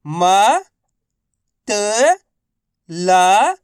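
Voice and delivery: म, त, ला each alone, held and rising; letter-by-letter drill. Shown as a demonstration. Teacher for students to repeat, म, त, ला drawn out singly. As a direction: sound out म, त, ला with long gaps between. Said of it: "एक अक्षर का उच्चारण".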